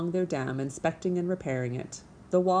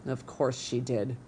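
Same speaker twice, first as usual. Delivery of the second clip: slow, lower grumble to himself; muttered aside; 4 semitones lower than usual. Acted. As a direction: grumble it under your breath. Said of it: "Mister Beaver muttered to himself".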